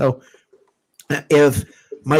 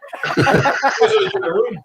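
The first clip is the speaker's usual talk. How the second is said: bursting out laughing; big laugh; 11 semitones higher than usual. Real, not acted. Reacting to the odd compliment.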